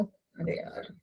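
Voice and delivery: under-breath whispered arre yaar; annoyed whisper to herself; whispered sigh. Real, not acted. Teacher mutters to herself while downloading. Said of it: "frustrated at download".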